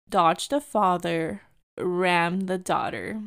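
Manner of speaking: slow, low, weighted delivery; deliberate read-out. Retold or imitated. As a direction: spell out the awful slogan slowly and low. Reads out the offensive license plate.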